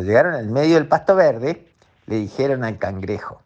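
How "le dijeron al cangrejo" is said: plain reading voice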